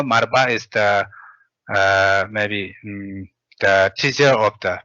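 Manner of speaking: normal talking voice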